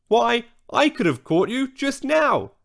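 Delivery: a hint of a girl crying out in protest; cried out. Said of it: "cried Kia".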